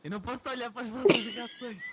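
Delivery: fast, high, loud giggly protest; real flustered laughing talk; laughing embarrassed. Real, not acted. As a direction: laugh through it, can't look at them. Reader embarrassed by listeners' reactions.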